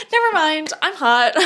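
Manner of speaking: mock confident boast. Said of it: "you're like".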